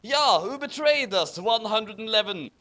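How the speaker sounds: a hint of an angry vampire shout. Vampire yelling at the traitors, mild mock anger. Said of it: "shooted Vampire angrily".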